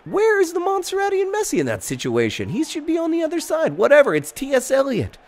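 high, theatrical sportscaster play-by-play; mock commentator. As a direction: call the goal like an over-the-top announcer.

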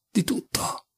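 breathy whisper, voice dropped away; whispered repeat. Acted. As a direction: let the repeat fall into a whisper. Ripete la frase sussurrando. (ripetuto in un sussurro)

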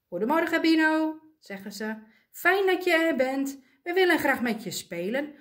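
cheery greeting. Acted. Penguins brightly greeting their sulking friend Bino. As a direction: sing out a bright good morning.

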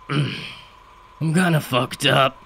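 slow, groaning wreck of a man; wasted father, groaning slowly; groaned. A narrator's reading. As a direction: drag it out in a gravelly groan. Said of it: "he groaned".

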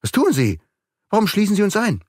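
high, startled questions; surprised outcry. A narrator's reading. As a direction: demand an explanation in alarm. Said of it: "rief Beautrelet völlig überrascht".

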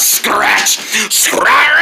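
harsh shouted screech; attacking tiger. Acted. Tiger scratching, harsh screech. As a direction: screech the word like a clawing cat.

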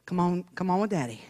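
lightly voiced low father; gently coaxing daddy. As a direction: coax a child along, low. Acts her father coaxing the child.

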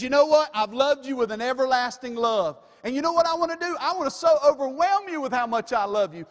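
loud, high fervent preaching; full-force preaching. Acted. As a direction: preach it out with rising fervor.

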